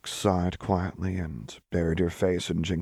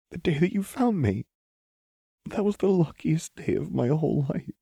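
higher, tearful and overwhelmed; tearful gratitude; 10 semitones higher than usual. A narrator's reading. Lux telling Jinx her luckiest day, tearful. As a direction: let the joy crack into tears. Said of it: "with a small sob".

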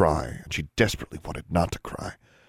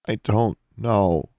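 slow, strained, higher voice; thick-voiced reply; 5 semitones higher, 4 dB louder, much slower than usual. A narrator's reading. Girl asked where she was, replying thickly. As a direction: drag each word out past a lump in the throat. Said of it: "Alexandra replied thickly".

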